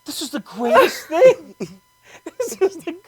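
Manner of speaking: hammed-up thrill at a thousand bunnies; mock delight. Imagining a field of bunnies, gushing with mock joy. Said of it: "and I would have went".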